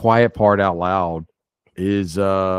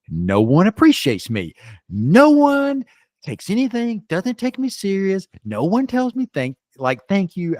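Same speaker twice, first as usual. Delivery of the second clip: much higher, whiny self-pitying rant; mock self-pity; 12 semitones higher, 3 dB louder than usual. Venting to a sober buddy in a store, high, whiny self-pity. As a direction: whine like nobody ever thanks you.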